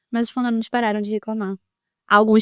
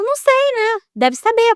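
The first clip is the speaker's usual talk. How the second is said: much higher, sharp mock defensive snap; defensive retort; 13 semitones higher, 5 dB louder, slower than usual. Neighbor mother snapping back at the building manager, defensive. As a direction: fire it back as if attacked. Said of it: "Ela já começou a armar".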